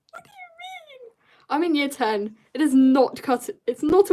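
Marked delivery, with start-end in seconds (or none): 0.1-1.1 very high, very soft squeal; faint high-pitched whine; tiny squeak. Real, not acted. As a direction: squeak it out in baffled disbelief. Repeats protest in squeaky voice.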